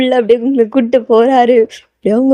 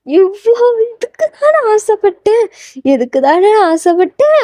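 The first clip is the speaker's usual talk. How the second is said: high, drawn-out, overcome exclamation; eager, overcome longing; 9 semitones higher, slower than usual. Acted. Fan finally seeing the longed-for concert, overcome. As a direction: stretch it out high, as a long wish comes true. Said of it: "அவ்வளோ ஒரு ஆர்வத்தோட".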